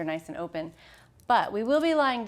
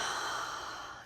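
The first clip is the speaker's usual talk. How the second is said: long audible breath out; exhale sigh; 10 dB quieter than usual. Shown as a demonstration. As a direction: let out a soft releasing sigh. Demonstrates exhale releasing the shoulders.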